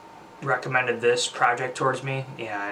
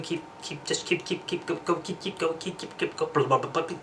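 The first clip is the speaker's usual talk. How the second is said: higher, tumbling stream of keep and go; garbled babble; 5 semitones higher, 4 dB quieter than usual. Real, not acted. Ending the vlog, words tumble into higher babble. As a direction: let the words dissolve into nonsense. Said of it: "I don't know what the hell that was".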